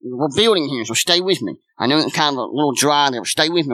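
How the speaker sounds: ordinary talk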